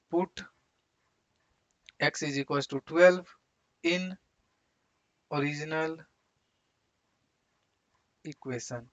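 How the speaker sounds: halting phrases with long pauses; slow dictation. Real, not acted. Teacher writing the verification step, slow dictation. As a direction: spell out the step slowly, piece by piece.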